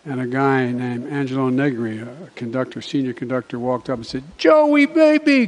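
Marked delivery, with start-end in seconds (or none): 4.4-5.5 big drawn-out joyful call; booming hearty hello; loud greeting. Retold or imitated. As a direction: belt it out like an old friend spotting you. Conductor greets him loudly.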